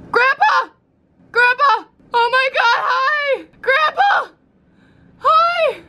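high-pitched shouted hail; excited calling out. Pretend. Vlogger spots grandpa from the hotel window, excited shouting. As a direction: call out to someone far below.

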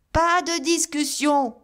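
high, loud, slow shout; yelled. A narrator's reading. Sherman refusing the visitor, high loud yell. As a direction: yell to cut him off. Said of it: "hurla monsieur Sherman".

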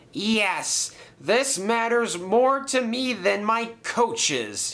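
high, drawn-out aching reply; aching reluctance. A narrator's reading. James giving up his coaches to Sir Topham Hatt, achingly. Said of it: "he said achingly".

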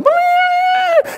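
high, loud held keyboard wail; blasting high musical whoop; imitated keyboard sting. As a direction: shriek out the organ's sting. Mimics excited church keyboard.

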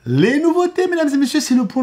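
high, loud presenter flourish; grand host calling to the crowd; showman announcement. Acted. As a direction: announce it like a ringmaster. Showman announcing channel news.

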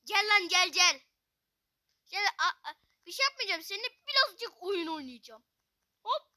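loud, teasing come-here taunt to the enemy; mock taunting call. Gamer loudly taunting the enemy fighter, mock menace. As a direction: lure the opponent in like a playful bully.

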